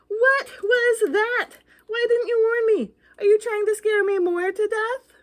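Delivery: high, loud frightened questions; hammed-up spooked ghost; mock panic. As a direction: panic and blame the reader.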